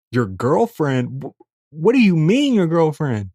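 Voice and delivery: can't believe what you just heard; stunned repeat of the word; shocked disbelief. Retold or imitated. Shocked she has a girlfriend.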